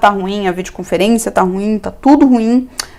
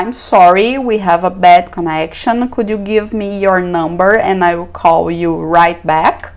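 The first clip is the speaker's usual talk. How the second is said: slow model phrase; 4 semitones lower, slower than usual. Shown as a demonstration. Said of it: "você pode usar".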